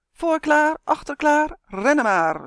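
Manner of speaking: high, loud starting call; roepend. A narrator's reading. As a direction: shout the go signal for a race. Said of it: "riep Justin". (luid en hoog roepen)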